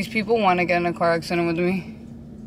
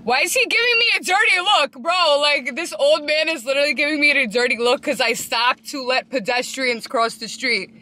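outraged; 6 semitones higher than usual. Real, not acted. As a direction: call out the dirty look. Outraged at old man's look.

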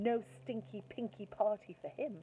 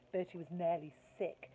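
ordinary delivery, nothing marked

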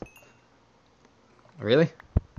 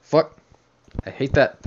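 startled curse; 6 semitones higher, 6 dB louder than usual. Real, not acted. Startled in cave.

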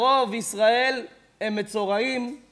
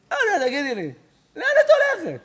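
mimicking; 7 semitones higher than usual. Rabbi mimics girl's question.

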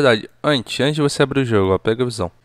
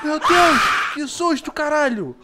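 scared shouting. Real, not acted.

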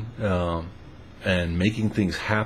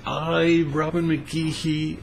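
mock formal begging; 6 semitones higher than usual. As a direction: make it a grand ceremonial vow. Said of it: "she just begged".

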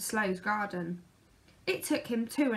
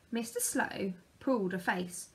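ordinary delivery, nothing marked

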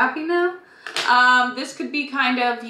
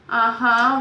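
normal talking voice

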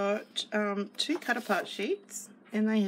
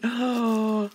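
delighted gasp; 2 semitones higher, 8 dB louder than usual. Real, not acted. Delighted at the sequin mix.

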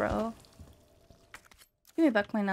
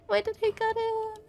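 much higher, whining the question; high whiny complaint; 11 semitones higher than usual. Real, not acted. Gamer whining at the game for cutting the music.

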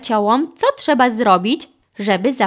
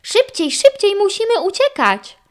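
high, raised cry to flee; urgent shout; 11 semitones higher, 4 dB louder than usual. A narrator's reading. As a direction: hurry them along, voice pitched up. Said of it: "krzyknął Carlos".

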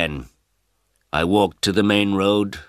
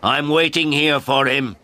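shouted; 8 semitones higher, 3 dB louder than usual. A narrator's reading. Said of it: "I shouted at her".

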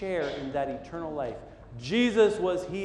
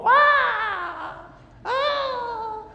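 put-on high shrieking child cry of pain; piercing little boy scream; child's pained scream; 9 dB louder than usual. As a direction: shriek like a hurt toddler. Toddler screaming, arm hurt.

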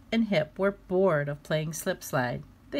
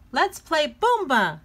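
high, loud eager ask; bubbling over to play; excited; 9 semitones higher, 6 dB louder than usual. Acted. Flop asking his brother to play, excited. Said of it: "said Flop excitedly".

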